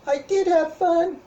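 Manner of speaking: put-on high, loud little kid voice; squeaky happy puppet child. Timmy puppet answers.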